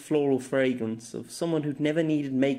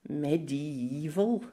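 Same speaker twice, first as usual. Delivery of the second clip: puzzled echo; 3 semitones higher, 4 dB quieter, much slower than usual. Acted. Girl puzzled by the word.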